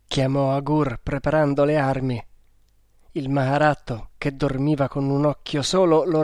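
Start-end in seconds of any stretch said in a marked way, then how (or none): none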